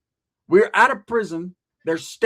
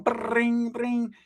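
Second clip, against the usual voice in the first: phone ring imitation; 5 semitones higher, 3 dB quieter than usual. Imitating the house phone ringing.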